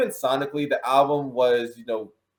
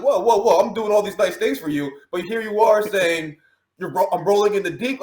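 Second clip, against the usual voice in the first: higher, louder mock objection; mock protest; 6 semitones higher, 4 dB louder than usual. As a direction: play an indignant lover objecting. Acting as an indignant lover.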